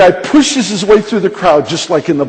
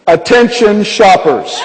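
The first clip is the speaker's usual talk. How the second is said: slow, stretched store announcement, higher pitched; intercom announcer; 4 semitones higher, 3 dB louder, slower than usual. Retold or imitated. Young man takes the grocery store intercom to address shoppers, announcing. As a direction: imitate a public-address call across a store. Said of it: "he takes the intercom and he goes".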